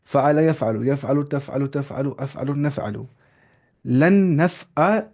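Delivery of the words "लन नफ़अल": लन नफ़अल drawn out slowly, लन held; teacher modelling लन नफ़अल slowly; slow model. Shown as a demonstration. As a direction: spell it out slowly for the class. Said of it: "मैं बोल रहा हूँ लन नफ़अल".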